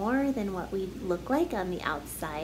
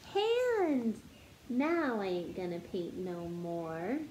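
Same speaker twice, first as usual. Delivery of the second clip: bouncy storybook narration building up the list; story reading; 3 semitones higher than usual. Acted. Teacher reading a silly picture book to kids, bouncy storytelling. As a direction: read it like a picture book to children. Sings-songy refrain, teasing guesses.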